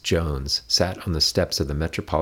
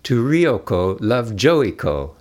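laughing; 6 semitones higher, 6 dB louder, much slower than usual. A narrator's reading. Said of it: "read it aloud and laughed".

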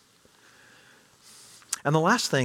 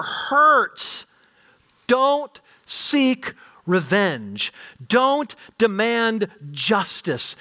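slow, weighted words, each one placed; slow and deliberate; 7 semitones higher, 3 dB louder, much slower than usual. Acted. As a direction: drag it out to land it. Slowing emphasis on key words.

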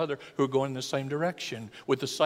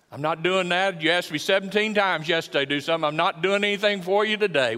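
louder, higher fed-up protest; mock indignant refusal; 4 semitones higher, 6 dB louder than usual. Wife imagined refusing her husband's requests, mock indignant. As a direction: act out an exasperated spouse putting their foot down.

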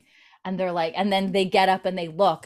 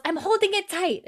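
put-on little kid voice; 13 semitones higher than usual. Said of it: "he goes".

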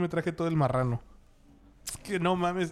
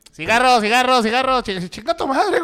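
street vendor calling out; 9 semitones higher, 11 dB louder than usual. Retold or imitated. Imitating street cigarette sellers.

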